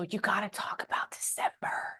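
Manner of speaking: imitating a spirit, hushed whisper; mock-secret whispered quote; whispered spirit message. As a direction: relay it in a soft whisper. Voicing a spirit's message from earlier.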